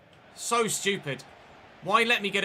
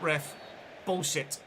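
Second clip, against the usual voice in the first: normal talking voice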